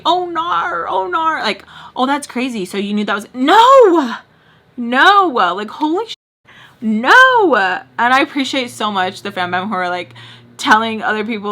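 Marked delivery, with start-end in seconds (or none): 0.0-1.4 higher, playful "Oh, nar" as she'd say it; her usual exclamation. Shown as a demonstration. Showing fans how she says it, playful catchphrase. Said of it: "Like, when I say". 3.3-7.5 shocked yell. Shown as a demonstration. Reacting loudly to something shocking.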